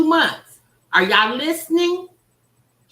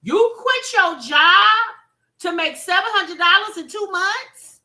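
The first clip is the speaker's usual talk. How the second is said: incredulous retort; 6 semitones higher, 6 dB louder than usual. Retold or imitated. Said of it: "I said".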